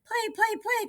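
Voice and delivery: very high eager squeal; excited baby. Acted. Excited baby goose begging Jack to play, very high. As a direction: bounce with excitement. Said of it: "cried the excited baby goose".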